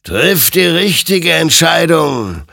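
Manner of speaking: high, loud, drawn-out warning; stern admonition. A narrator's reading. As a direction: admonish him slowly and forcefully. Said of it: "ermahnte ihn sein Gegenüber".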